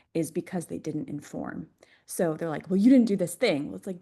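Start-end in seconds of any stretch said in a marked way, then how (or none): 2.7-3.7 louder, higher complaint, lightly acted; mock angry manifestor. Manifestor complaining to someone who didn't act, mock angry. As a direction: imitate an indignant complainer with a touch of anger. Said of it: "they're going to feel really angry".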